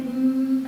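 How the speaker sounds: long held monotone "hmm", overdone filler; hesitant hmm. Shown as a demonstration. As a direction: drag out a thinking hum. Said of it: "sampai satu minit".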